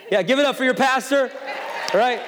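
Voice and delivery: high, loud playful shout; rally the crowd to clap for you; calling for applause. Real, not acted. Pastor hyping his own singing to church, jokingly shouting.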